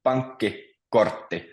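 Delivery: slow pankkikortti, split as pankki-kortti, with a clear R; teacher's slow model of pankkikortti, R fully sounded; clearly pronounced R. Shown as a demonstration. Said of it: "the R is also pronounced very clearly".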